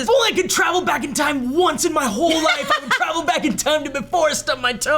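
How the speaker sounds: high, loud desperate wish; mock desperate shout. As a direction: wail it as if all is lost. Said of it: "My first thought is".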